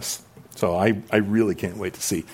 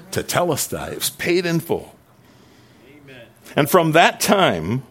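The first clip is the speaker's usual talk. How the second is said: raised, forceful sermon voice, higher and louder; preaching; 5 semitones higher, 3 dB louder than usual. Acted. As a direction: drive each line home to the congregation.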